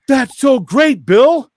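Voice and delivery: high, loud gushing encouragement; cheering supporters. Retold or imitated. Imitating online viewers cheering on his walk, loud gushing praise. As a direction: cheer him on like an adoring crowd.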